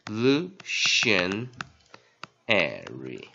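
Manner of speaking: "revolutionary" very slow, broken into syllables. Shown as a demonstration. Teacher introducing a hard word, "revolutionary" in slow separate syllables. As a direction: split "revolutionary" with a gap after each syllable. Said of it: "第二个就比较难了".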